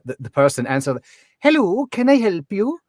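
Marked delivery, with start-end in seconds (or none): 1.4-2.8 high, lilting Highland Scottish greeting; sing-songy Highland accent. Retold or imitated. Bed and breakfast host answering a caller, sing-songy Highland accent. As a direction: answer the phone in a singing Highland lilt. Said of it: "so sing-songy".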